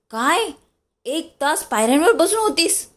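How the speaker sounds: high, startled disbelief; elder brother shocked to hear she waited outside, high and startled. A narrator's reading. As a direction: gasp it out in disbelief. Dada shocked at Priya's wait.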